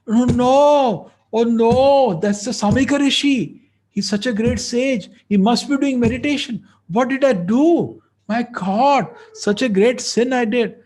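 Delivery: high, loud distraught self-reproach; anguished dismay. Retold or imitated. King horrified after insulting the sage. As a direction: panic at what you have done. King realizing his sin.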